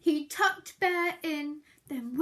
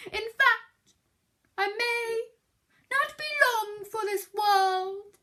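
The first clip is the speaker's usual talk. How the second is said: high, louder huffy bear; huffed; 6 semitones higher, 6 dB louder than usual. Acted. Bear telling Mouse he is dying, huffy moan. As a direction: huff it melodramatically. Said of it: "Bear huffed".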